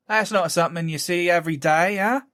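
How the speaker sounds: lilting Cockney woman; Cockney accent. Acted. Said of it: "A woman's Cockney voice".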